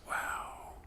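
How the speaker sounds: soft breathy wow; awed whisper. Retold or imitated. Walter hears about the visit, whispering in awe. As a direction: let the wonder slip out in a whisper.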